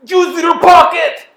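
shout. Real, not acted. Shouting advice across table.

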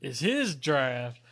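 slow, even, dragging delivery; slow monotone. Real, not acted. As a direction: draw every word out flat. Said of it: "if you could talk a little bit slower".

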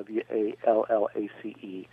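W A L L A C E, each letter said separately; spelled out. Shown as a demonstration. Host spelling an author's surname for listeners, letter by letter. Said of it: "let's just use Daniel Wallace's name".

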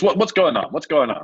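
quick, fussy American director asking what's up; American accent. Retold or imitated. Director demands to know what's going on, in an American accent. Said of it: "Another American".

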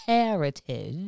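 slow, stretched heritage said with weight; drawn-out heritage. Real, not acted. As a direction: lean on heritage proudly and slowly.

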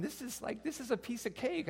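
high, soft, carefree voice; imitated naive self-assurance; mock breezy confidence. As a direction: go light and high, as if it's easy. Mock confidence during labor.

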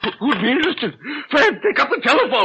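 high, loud furious order; angry demand. Acted. Father ordering stepson to pick up the phone. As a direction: snap the command at him.

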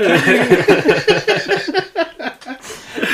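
high, loud burst of laughter; big laugh. Real, not acted. To friends after the dream joke, a high loud laugh. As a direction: crack up at his own joke.

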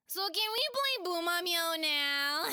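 put-on high, loud giggly pleading kid; a kid wheedling to play; begging child. Pela begs friends to play now, giggling. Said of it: "begged Pela".

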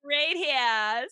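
high, drawled Boston-style right here; imitating New England accent. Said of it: "the New England accent".

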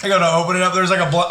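usual speaking voice

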